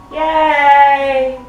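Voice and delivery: ordinary delivery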